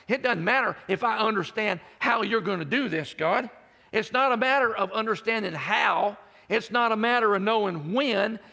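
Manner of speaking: high, loud preaching build; full-force preaching. Acted. Preacher urges the congregation to trust God, full force. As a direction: build to a rousing declaration.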